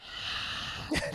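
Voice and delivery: long imitated sigh; wordless huff; huffy exhale. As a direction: breathe out hard in mock annoyance. Imitates Laura's disgusted exhale.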